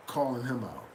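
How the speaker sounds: ordinary delivery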